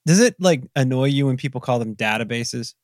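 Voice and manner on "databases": "databases" with a short a, as DAT-uh-bases; variant pronunciation. Shown as a demonstration. Host asks about others' pronunciation, "databases" said DAT-uh-bases. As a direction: say "databases" starting like "dad", not "day". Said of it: "I hear it both ways".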